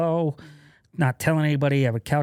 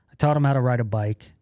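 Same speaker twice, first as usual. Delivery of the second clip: usual speaking voice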